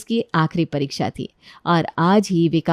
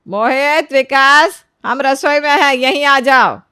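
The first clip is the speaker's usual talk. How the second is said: loud, raised-pitch summons; calling out; 5 semitones higher, 7 dB louder, slower than usual. Acted. Mother-in-law summons her sons to the kitchen, calling loudly. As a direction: holler to someone in another room.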